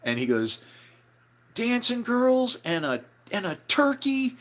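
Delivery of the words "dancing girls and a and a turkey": high, slow, astonished announcement; amazed excited cry. Retold or imitated. [name] reporting the party to bandmates, amazed cry. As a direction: imitate someone yelping out unbelievable news. [name] repeats the amazing news.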